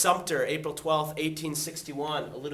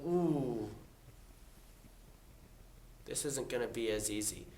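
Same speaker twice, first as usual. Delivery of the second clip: softer, lower worried ooh; uneasy soldiers; 4 semitones lower, 10 dB quieter than usual. Retold or imitated. Both sides after Bull Run, softer worried sigh. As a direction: let the worry sink in. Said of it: "both sides are going".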